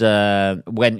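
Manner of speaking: ordinary delivery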